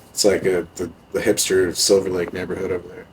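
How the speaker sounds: ordinary talk, nothing marked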